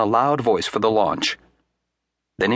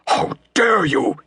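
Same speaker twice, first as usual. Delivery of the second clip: a touch of outrage; lightly voiced indignant uncle; mild outraged cry; 7 semitones higher, 4 dB louder, slower than usual. A narrator's reading. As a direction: cry out, offended. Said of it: "he cried".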